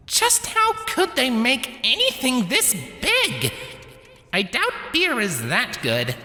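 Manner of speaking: high-pitched, slow wondering voice; heroine's amazed thought. A narrator's reading. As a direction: marvel slowly in a high little voice.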